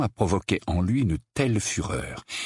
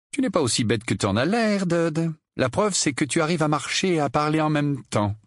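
high, mock-admiring tease; sarcastic Harry; 8 semitones higher than usual. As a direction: praise your cousin with obvious sarcasm. Harry mocks cousin's intelligence. (sarcasme faussement admiratif, voix haute)

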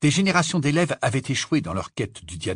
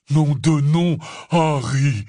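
loud, raised, winded outburst; panting exclamation; 5 semitones higher, 6 dB louder, much slower than usual. A narrator's reading. Hagrid exclaiming to Harry after his tumble, panting. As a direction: exclaim it short of breath. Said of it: "s'exclama Hagrid d'une voix haletante".

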